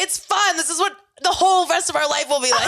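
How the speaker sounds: high, fast, manic cheer; mock cheerful. Mock reply about being wasted.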